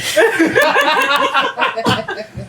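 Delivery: high burst of laughter. Real, not acted. Laughs after mock proposal.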